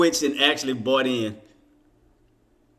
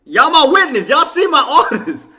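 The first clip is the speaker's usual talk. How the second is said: louder, higher laughing plea to chat; laughing appeal; 12 semitones higher, 8 dB louder than usual. Real, not acted. To his viewers about the missed orders, laughing appeal. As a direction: call on the viewers as witnesses.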